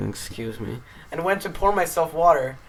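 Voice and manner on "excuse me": softly, under the breath; quiet. Retold or imitated. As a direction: murmur it politely. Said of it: "I quietly said".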